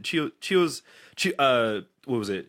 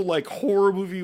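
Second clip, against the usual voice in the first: ordinary talk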